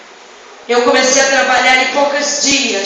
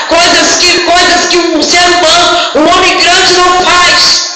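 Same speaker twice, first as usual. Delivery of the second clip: insistent, raised preaching voice; full-force preaching; 3 semitones higher than usual. Real, not acted. Preacher telling how her daughter led worship, full-force preaching. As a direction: build each phrase for the congregation.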